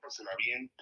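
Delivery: whispered goodbye. Real, not acted. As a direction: breathe it out softly. Whispered farewell.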